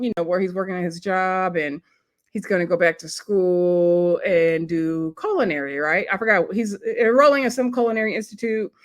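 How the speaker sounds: usual speaking voice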